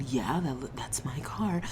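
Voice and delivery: soft unvoiced whisper of disbelief; whispered realization. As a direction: whisper it as it sinks in. Quiet realization of stolen car.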